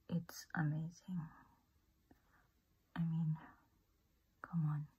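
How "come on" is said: ordinary delivery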